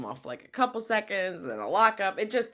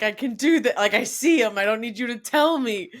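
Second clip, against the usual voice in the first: excited; 7 semitones higher, 10 dB louder than usual. Retold or imitated. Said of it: "Lewis is just like".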